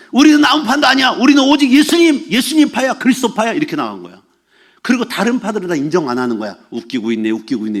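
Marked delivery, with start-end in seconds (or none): 0.1-3.5 loud, raised-pitch factional boast; insistent proclamation. Acted. Church faction member outdoing rival factions, insistent proclamation. 6.7-7.8 low, dismissive aside; dismissive scoff. Acted. Faction member rejecting the other factions, dismissive scoff.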